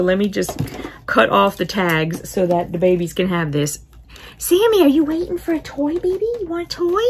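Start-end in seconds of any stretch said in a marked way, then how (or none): 4.4-7.0 pet talk. Real, not acted. Talking to her cat.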